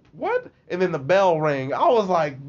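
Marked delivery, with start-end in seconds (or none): 0.1-0.5 high-pitched rising question; baffled teacher. Retold or imitated. Teacher who saw nothing, high-pitched bafflement. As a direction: squeak it like a bewildered teacher.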